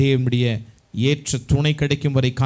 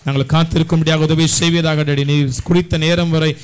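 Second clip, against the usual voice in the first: loud, rhythmic praise and pleading; real, insistent prayer at raised volume; fervent prayer; 2 semitones higher, 4 dB louder than usual. Real, not acted. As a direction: lead a congregation in impassioned prayer. Leads congregation in fervent prayer.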